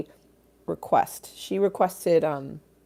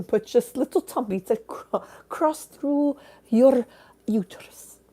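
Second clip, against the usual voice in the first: high-pitched mock pronouncement; mock man's speech; 6 semitones higher than usual. Voices a man quoted in joke.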